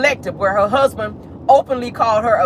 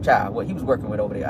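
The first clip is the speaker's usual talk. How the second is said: normal talking voice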